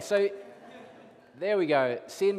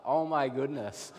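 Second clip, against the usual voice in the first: normal talking voice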